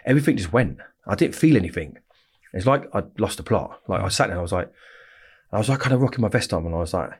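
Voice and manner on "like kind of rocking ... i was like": ordinary delivery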